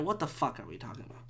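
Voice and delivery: low, soft, hurried mutter; muttered disbelief. Real, not acted. Podcaster questioning his own rant, low hurried mutter. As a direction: mutter it quickly under your breath. Ranting about the yelling stranger.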